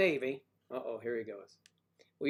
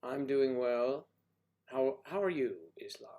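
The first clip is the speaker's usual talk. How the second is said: ordinary talk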